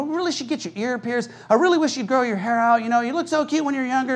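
a hint of a wheedling mother, kept soft; lightly voiced nagging mom. Mom pushing her agenda on her son, lightly voiced nagging.

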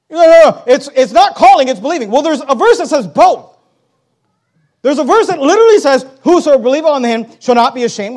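loud, high, insistent preacher; forceful preaching rebuttal. Acted. Preacher rebutting an objection about calling, forceful preaching. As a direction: hammer the argument home loudly.

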